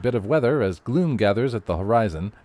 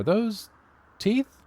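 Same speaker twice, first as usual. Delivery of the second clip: surprised; 11 semitones higher than usual. Acted. Reporter spots teeth in clouds.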